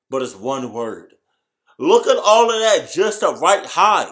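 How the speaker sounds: loud, high, stunned disbelief; amazed outburst. Real, not acted. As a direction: marvel loudly at the spelling. Amazed at the Chinese spelling.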